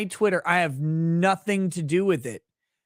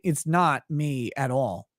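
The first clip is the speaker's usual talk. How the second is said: ordinary delivery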